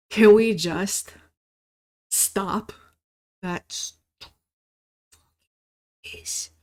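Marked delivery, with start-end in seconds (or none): none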